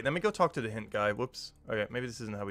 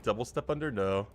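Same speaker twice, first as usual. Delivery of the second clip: usual speaking voice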